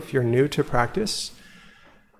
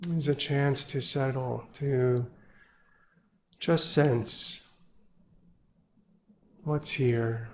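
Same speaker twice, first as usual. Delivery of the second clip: guided meditation; 4 dB quieter than usual. Acted. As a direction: ease them into stillness, gently and unhurried. Leads evening sit meditation.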